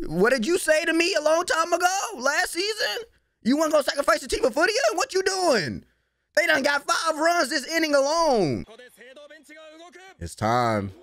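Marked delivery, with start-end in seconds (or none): 0.1-8.6 quoting the coach in a much higher, fast voice; squeaky, rushed playback of the coach's old words; mock coach. Reactor mocking the coach's old advice, in a high, fast mock voice. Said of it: "I had to let him know".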